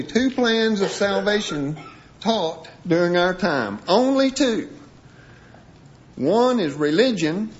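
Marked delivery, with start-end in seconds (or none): 3.9-4.7 "Only two" set apart, pitched high; emphatic reading. Real, not acted. Preacher reading an article to listeners, emphatic on "Only two". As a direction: punch "Only two" as the writer's capitals. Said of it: "emphasize the words he's emphasizing".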